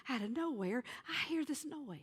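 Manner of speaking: normal talking voice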